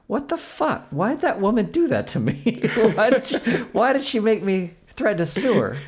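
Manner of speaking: high, laughing disbelief; mock disbelief. Telling a friend about the refund, high incredulous laugh. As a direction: sputter in amused outrage. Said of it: "incredulous outburst".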